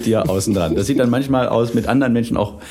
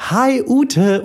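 very high, chirpy phone greeting; mother's put-on cheerful phone voice; imitated sudden cheeriness; 12 semitones higher than usual. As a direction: switch to a sweet, high-pitched hello. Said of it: "komplett eine andere Stimmungsumschwankung".

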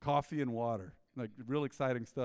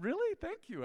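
touched surprise; 14 semitones higher than usual. Retold or imitated. Touched reply to the clerk.

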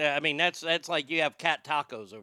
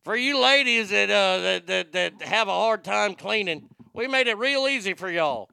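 put-on Oklahoma good old boy accent; 2 semitones higher, 6 dB louder than usual. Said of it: "good old Oklahoma boys".

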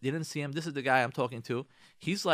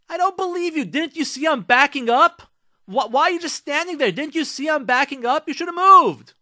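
raised, high-pitched indignant yelling; retold angry screaming; 11 semitones higher, 10 dB louder than usual. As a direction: act out an irate driver yelling at someone. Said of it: "he starts screaming at him".

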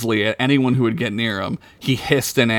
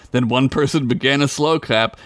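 ordinary delivery

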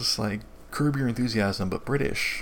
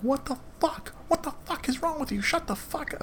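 high-pitched retold tirade, no real volume; mock dad yell. TV dad walks in on his playing kids, mock yelling. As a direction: imitate a dad blowing up in a squeal. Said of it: "to go yell at them".

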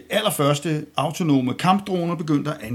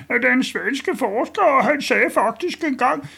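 mock Swedish-ish voice; 10 semitones higher than usual. Mimicking Magnus Millang.

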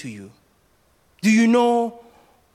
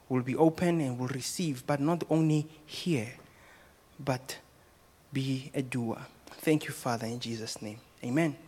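low, soft, hushed prayer voice; 7 semitones lower, 7 dB quieter than usual. Acted. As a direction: reverent closing prayer, lower and softer. Closing prayer before the sermon.

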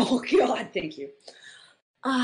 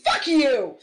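mock outrage; 4 semitones higher, 7 dB louder than usual. Mock outrage at wrong answer.

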